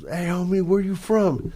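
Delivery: high, sharp where you from; high challenging call; gangster challenge. Retold or imitated. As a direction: call him out like a banger.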